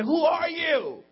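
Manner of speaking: high, slow, drawn-out shout; loud, stretched-out indignation; outraged shout. Acted. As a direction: hurl the challenge at a neighbour. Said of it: "Tell your neighbor".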